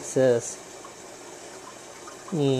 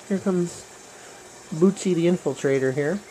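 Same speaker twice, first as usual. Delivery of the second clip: ordinary delivery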